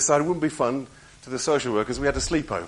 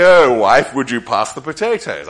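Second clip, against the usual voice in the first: mock pious voice; 14 dB louder than usual. Preacher mocking prayer voice used at dinner, loud and strained. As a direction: ask for potatoes in a holy prayer voice. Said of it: "Perhaps they say".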